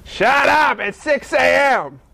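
stretched-out, irritated holler; mock yell. Woken neighbor at the window at six, irritated mock yell. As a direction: holler it out the window, drawing out the end. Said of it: "did I just really yell".

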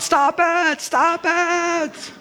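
very high screaming "stop it"; old lady screaming. Retold or imitated. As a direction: screech it like she can't stand the words. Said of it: "she would scream out".

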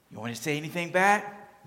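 ordinary talk

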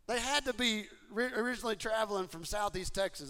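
ordinary talk, nothing marked

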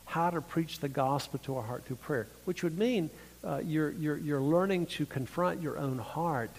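normal talking voice

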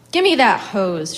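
loud, slow, angry order; snatch the hose and bark it at him; angry command. A narrator's reading. Woman orders the farmhand angrily.

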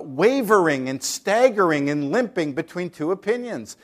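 loud, driving call to decide; full-force preaching. Acted. Preacher urging the congregation to choose, full force. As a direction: thunder the choice at them.

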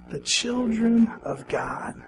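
slow, hushed whisper of the phrase; whispered echo. Shown as a demonstration. Preacher whispers the line with the congregation. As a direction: breathe it back to them softly. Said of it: "Say it with me".

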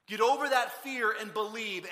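loud, slow, emphatic command; stern demand. Acted. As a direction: press each word on the doubter forcefully. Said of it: "He didn't walk in the room and say".

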